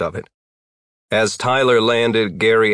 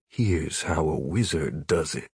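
low muttered aside, much softer; muttering; 4 semitones lower, 8 dB quieter than usual. A narrator's reading. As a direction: mutter it under your breath. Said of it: "Tyler looked down at Gary and muttered".

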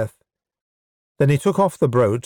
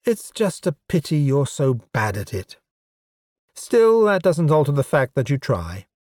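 a hint of a cool, higher-pitched elf woman; lightly voiced teasing elf; 3 semitones higher than usual. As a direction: lightly tease him in the elf's voice.

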